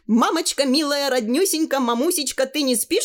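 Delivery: put-on high, gushing girl's cry; excited calling out. A narrator's reading. Tanya flies through the door calling to her mother, excited. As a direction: burst in calling, pitched well up. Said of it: "закричала она, влетая в дверь".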